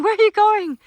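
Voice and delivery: high, loud mock wail; imitated crying plea. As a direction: playfully voice a baby animal calling after friends. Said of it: "he's just crying and crying".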